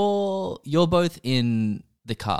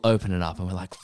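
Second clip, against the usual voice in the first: ordinary talk, nothing marked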